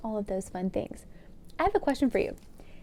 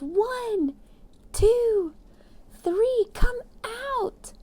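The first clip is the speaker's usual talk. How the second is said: high, loud counting chant; crowd yelling; 12 semitones higher, 5 dB louder, much slower than usual. A narrator's reading. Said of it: "The crowd yelled".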